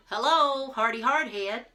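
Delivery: loud high call of a name. A narrator's reading. Jack calls his helper loudly from the ship. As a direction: shout the name across the ship.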